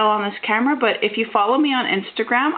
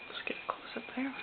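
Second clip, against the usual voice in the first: whispered aside; 5 semitones higher, 19 dB quieter, faster than usual. Real, not acted. Quiet aside while filming closeup.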